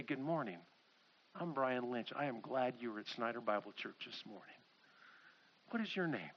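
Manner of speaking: low, soft greeting; gentle welcome. Acted. Church member greets an unfamiliar first-time visitor, gentle welcome. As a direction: introduce yourself quietly and kindly.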